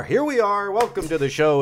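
high, loud show opener; excited intro. Real, not acted. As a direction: announce it with a big lift. Podcast intro, called out.

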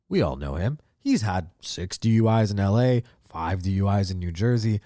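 usual speaking voice

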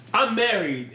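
mock emphatic rebuff. Retelling a flirting woman suddenly announcing marriage, mock rebuff. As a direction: blurt it out as a mock brush-off.

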